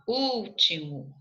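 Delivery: "Último" slowly, with a long stressed Ú. Shown as a demonstration. Teacher confirming the accented syllable, "Último" slow and stressed on Ú. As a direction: draw out the first syllable of "Último". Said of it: "Acento agudo no U".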